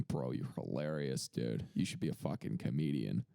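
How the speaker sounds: a Boston bro drawling his praise, low; Boston accent. Retold or imitated. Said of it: "Was that your Boston accent?".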